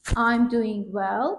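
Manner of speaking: slow demo. Shown as a demonstration.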